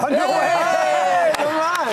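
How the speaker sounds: loud, high-pitched disbelief; amazed outcry. Real, not acted. Reacting to surprise shown on screen.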